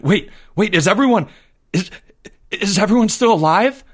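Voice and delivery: high, stammering, rising question; breathless with excitement. A narrator's reading. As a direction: gasp it out, restarting in excitement. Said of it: "now breathless with excitement".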